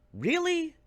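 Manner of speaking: exaggerated incredulous Really; exasperated disbelief. Real, not acted. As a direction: push the disbelief to the top.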